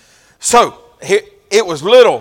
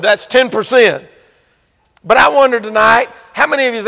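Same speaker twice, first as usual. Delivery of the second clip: higher and louder preaching, rising; preaching at full force; 6 semitones higher, 6 dB louder than usual. Acted. Preacher rousing the congregation about giving, preaching at full force. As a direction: lean into it like a revival preacher.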